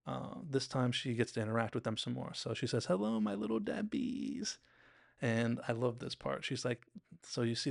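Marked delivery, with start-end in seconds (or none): 2.9-4.6 high, drawn-out singsong hello; singsong greeting. Retold or imitated. Star greeting her Little Debbies, singsong. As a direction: stretch it out high and sugary.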